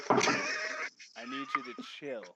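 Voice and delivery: calming. Pretend. Calming Ron down.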